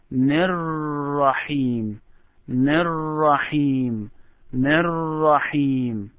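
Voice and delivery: Nir-Rahim drawn out slowly, linking the n into Rahim; slow recitation. Shown as a demonstration. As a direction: say Nir-Rahim very slowly as one joined phrase. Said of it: "repeat this after me".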